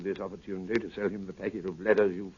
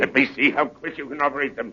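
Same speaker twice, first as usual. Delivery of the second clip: sharper repeated order; 5 semitones higher, 7 dB louder than usual. Acted.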